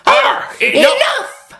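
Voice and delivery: high, loud, slow snap; snapped. Acted. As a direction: cut them off in anger. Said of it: "they snapped".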